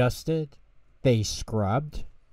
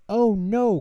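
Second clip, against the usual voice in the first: high, loud wail of dismay; alarmed cry; 11 semitones higher, 6 dB louder than usual. A narrator's reading. Tutter hears the doorbell, high loud alarmed cry. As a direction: cry out in panic. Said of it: "cried Tutter".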